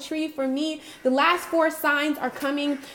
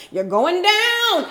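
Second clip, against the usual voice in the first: mock doom warning; 7 semitones higher, 8 dB louder, much slower than usual. Mock church hellfire warning.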